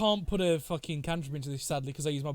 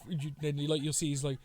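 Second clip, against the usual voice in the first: ordinary talk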